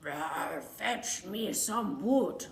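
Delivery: slow, low dragon voice; dragon Zog. Acted. Zog the dragon asking for wood, slow and low. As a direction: command it as the dragon. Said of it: "So Zog said".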